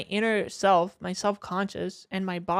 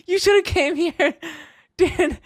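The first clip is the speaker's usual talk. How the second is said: much higher, laughing through the complaint; half-laughing whine at a friend; 8 semitones higher than usual. Retold or imitated.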